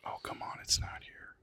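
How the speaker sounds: whispered grumble under the breath; muttering to himself. Real, not acted. Host hunting for a definition on his device, muttering. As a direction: whisper it to yourself while searching.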